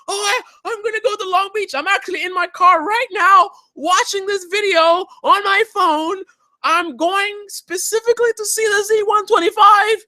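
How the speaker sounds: high-pitched mock fanboy gushing; overexcited fan. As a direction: play an eager fan in a squeaky-high voice. Mimics an overexcited viewer.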